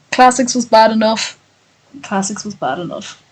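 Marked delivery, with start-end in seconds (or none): 2.0-3.2 much softer, trailing repeat; quiet aside. Real, not acted. Streamer repeating a complaint to themselves, hushed aside. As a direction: say it under your breath, as if to yourself.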